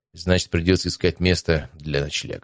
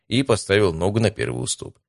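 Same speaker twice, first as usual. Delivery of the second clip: plain reading voice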